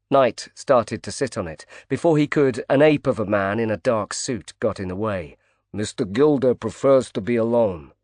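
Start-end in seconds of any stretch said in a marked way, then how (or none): 5.7-7.9 put-on a bodyguard's Brooklyn accent, blunt and firm. Bodyguard blocks Knight from Gilder, in a Brooklyn accent. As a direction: tell him off in a Brooklyn accent. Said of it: "he said in a distinct Brooklyn accent".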